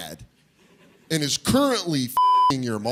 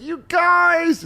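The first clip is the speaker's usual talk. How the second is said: high-pitched gushing mom greeting; excited mom; 3 dB louder than usual. Retold or imitated. Mom greeting her visiting son and Katie, imitated excited squeal. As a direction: squeal it like a delighted mother. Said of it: "She's so excited for us".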